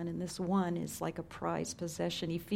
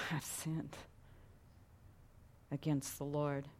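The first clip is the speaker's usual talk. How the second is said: quiet confession; 3 semitones lower, 11 dB quieter than usual. Retold or imitated. David's confession repeated.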